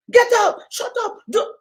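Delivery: high, loud mock barking at a child; mock-angry parent shouting orders; scolding parent.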